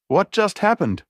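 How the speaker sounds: high panicked shout; screaming. A narrator's reading. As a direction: cry out in shock. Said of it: "Joseph screamed".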